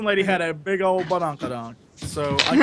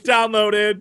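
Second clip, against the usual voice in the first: normal talking voice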